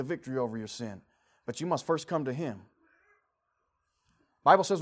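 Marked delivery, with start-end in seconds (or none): none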